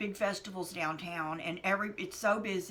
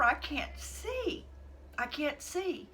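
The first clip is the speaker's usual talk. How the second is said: desperate; 8 semitones higher, slower than usual. Retold or imitated. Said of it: "And I'm like".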